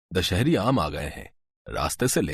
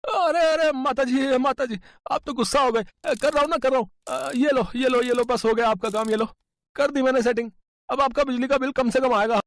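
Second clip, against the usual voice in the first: cheeky electrician. Acted. Electrician boy haggling with the old mother for more bribe, sly and high. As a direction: play a greedy shop boy haggling with a laugh.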